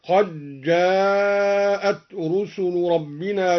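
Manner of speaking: "قد جاءت" merged as qaj-jaa'at, long held aa; assimilated dal. Shown as a demonstration. Teacher shows same rule, "قد جاءت" as qaj-jaa'at. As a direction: slowly recite "قد جاءت" with a doubled jim. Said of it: "وكذا قوله تعالى".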